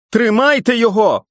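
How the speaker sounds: very high, loud yell; panicked high yell. Acted. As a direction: scream the order. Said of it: "волав Гарднер".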